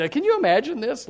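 usual speaking voice

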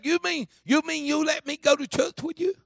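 high, loud imitation of an incredulous kid; amazed boy. Willie asks preacher about church, in high-pitched amazement. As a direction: play a boy who can't believe the offer.

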